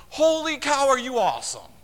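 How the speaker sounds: high, loud gushing wow; hammed-up, loud, high admiration; mock amazed praise. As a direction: gush like you're dazzled. Imagined praise from Jesus.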